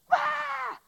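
imitating a baby's sudden high wail. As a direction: let out a loud, high baby howl. Imitating his baby son's cry.

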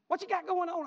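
mimicked mama, high and loud; nosy mama. Mama questioning her son on the phone, high loud mimicry.

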